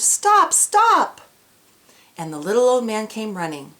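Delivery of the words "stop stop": high, loud desperate scream. Acted. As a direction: scream after the runaway cookie. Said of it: "The little old woman screamed".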